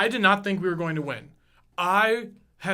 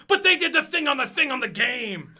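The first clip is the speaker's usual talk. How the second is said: put-on high, louder childish protest; whiny excited kid; 6 semitones higher, 5 dB louder than usual. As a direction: plead like an overexcited kid. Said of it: "And I'm like".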